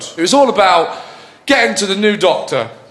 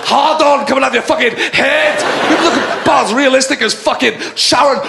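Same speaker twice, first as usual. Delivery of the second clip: higher, ranting furious shouts; Malcolm Tucker rant; 5 semitones higher than usual. Acted. Capaldi as Doctor Who screaming at everyone, furious rant. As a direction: tear everyone apart in a sweary rage. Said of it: "Walking into the building going".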